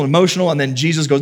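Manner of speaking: usual speaking voice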